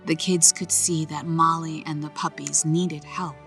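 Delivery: ordinary delivery, nothing marked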